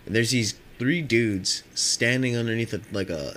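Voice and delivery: normal talking voice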